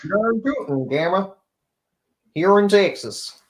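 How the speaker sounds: slow, high put-on Texan drawl on the name; Texas accent. Teasing Gemma about her name in a put-on Texan accent. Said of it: "now he's doing his Texas thing".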